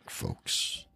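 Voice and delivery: usual speaking voice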